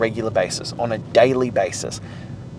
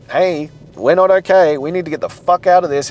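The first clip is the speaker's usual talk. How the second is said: body's voice raised higher and louder in alarm; mock scream; 6 semitones higher, 5 dB louder than usual. Body panicking in an ice bath, higher louder mock yell. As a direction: shout the panic like a body wanting out. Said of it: "Your body is screaming that".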